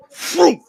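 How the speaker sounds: loud, high froom; mimicking the baby popping out, a loud high froom; popping sound effect. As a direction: blurt out a loud pop noise. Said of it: "the pussy goes".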